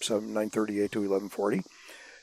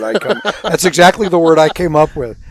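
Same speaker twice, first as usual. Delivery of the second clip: real laugh, then louder on exactly; laughing agreement; 6 semitones higher, 12 dB louder than usual. Real, not acted. Agreeing with his friend about the daring rider, laughing loudly. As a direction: laugh through it, delighted they agree.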